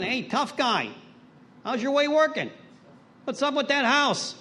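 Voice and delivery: high, loud mock gangster greeting; lightly voiced tough old-timer ribbing; Dennis impression. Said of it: "that's exactly what he'd say".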